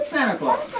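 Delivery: a chuckling, lifted "Santa Claus"; playful high Santa Claus. Acted. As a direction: sing out "Santa Claus" high and amused, rising at the end. Punchline name for English speakers.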